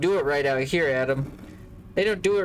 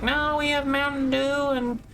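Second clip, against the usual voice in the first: much higher, put-on server voice; mocking waiter; 11 semitones higher than usual. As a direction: ape a chirpy server offering drinks. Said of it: "Out here, it's always like".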